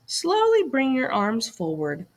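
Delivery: ordinary delivery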